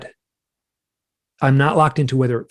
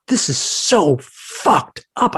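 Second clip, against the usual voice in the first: outraged, slow and higher, without a full scream; retold screaming; 5 semitones higher, much slower than usual. Man protesting to the beings, retold angry scream. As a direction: drag out each word in mounting outrage. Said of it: "I was, like, screaming at them".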